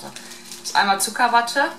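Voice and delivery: ordinary talk, nothing marked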